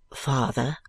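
low, meek address; very submissive. A narrator's reading. Son answering his aghast father, submissively. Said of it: "returned Prince with great submission".

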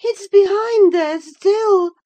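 high, loud, anxious cry; frightened woman, voice pitched right up. A narrator's reading. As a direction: dread rising, slow and high-pitched. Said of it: "she pursued anxiously".

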